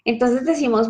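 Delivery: ordinary talk, nothing marked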